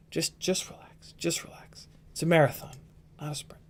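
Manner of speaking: near-whispered, calming himself down. Real, not acted. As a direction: drop to a whisper to settle the hype. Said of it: "Bring my voice down".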